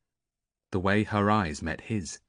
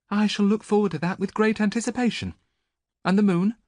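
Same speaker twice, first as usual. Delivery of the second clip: higher, louder, mock-eager priestess; mocking young woman; 13 semitones higher, 6 dB louder than usual. A narrator's reading. Andromache mocking the prophecy, higher and sarcastic. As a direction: tease the seer with false enthusiasm.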